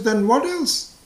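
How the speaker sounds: hammed-up frustrated demand; exasperated wife. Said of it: "So she says".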